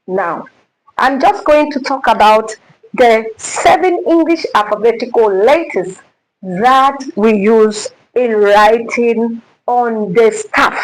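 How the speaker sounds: ordinary talk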